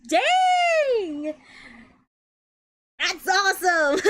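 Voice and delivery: high-pitched exclamation breaking into laughter; real delight. Real, not acted. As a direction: cheer for a friend's good luck, pitch way up. Delighted at friend's nearby restaurant.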